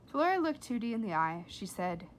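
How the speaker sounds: plain reading voice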